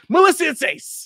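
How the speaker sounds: high, loud comic impression; hammed-up high phone greeting; Ace Ventura. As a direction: cry it out in an over-the-top movie voice. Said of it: "that line from Ace Ventura".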